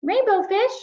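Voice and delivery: high, small fish voice calling out; a little fish calling after a friend. Acted. Little blue fish calls after Rainbow fish, high small voice.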